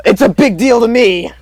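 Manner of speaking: high, loud protest; a sudden loud cry of indignation; indignant outburst. Real, not acted. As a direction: insist it matters, loudly. Heated argument about being right.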